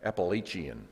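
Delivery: Appalachian drawn into five syllables, "appa-LAY-chee-un"; New Jersey pronunciation. Shown as a demonstration. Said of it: "New Jersey and Long Island, they say".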